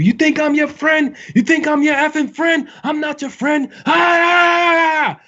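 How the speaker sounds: high-pitched hammed-up drill instructor screaming in your face. Drill instructor screaming at a recruit who laughed, high mock yell. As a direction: yell like a drill sergeant who has lost all patience. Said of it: "Like, something like that".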